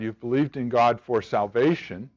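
ordinary talk, nothing marked

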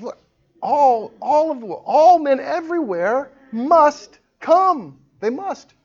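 high, loud, drawn-out preacher's command; emphatic proclamation. Acted. Preacher voicing God's command to all men, emphatic and high. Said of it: "But he says".

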